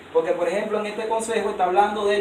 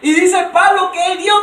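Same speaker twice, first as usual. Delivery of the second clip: loud, high, driving sermon voice; fiery preaching; 7 semitones higher, 8 dB louder than usual. Acted. A preacher hammering the point home to his congregation, loud and high. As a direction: preach it out with rising fire.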